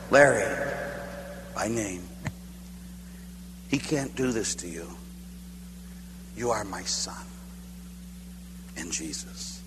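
very soft, low, long pauses; hushed divine reassurance, phrase by phrase; inner voice of God. Retold or imitated. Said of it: "I heard the same thing".

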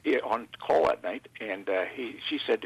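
normal talking voice

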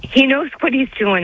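emotional. Real, not acted. Caller tells the host of her faith, real emotion breaking her voice. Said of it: "I'm sorry to get emotional".

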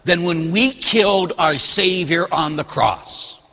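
preaching. Acted. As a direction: drive it home from the pulpit.

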